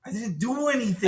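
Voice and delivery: high, swooping protest of innocence; hammed-up indignant whine; mock complaining. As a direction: complain loudly in a put-on voice. He just complains to Gabriel.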